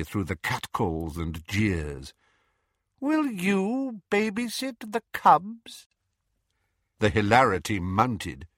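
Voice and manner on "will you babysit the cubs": high, slow mocking hiss; Voldemort voice. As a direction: taunt him coldly.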